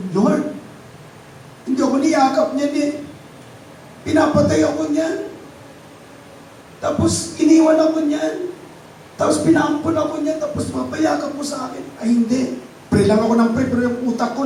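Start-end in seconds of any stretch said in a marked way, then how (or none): 0.1-11.8 high, pleading protest; arguing with God. Retold or imitated. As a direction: push back at the Lord in disbelief. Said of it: "nakikipag-away ako". (pakikipagtalo sa Panginoon, mataas ang boses)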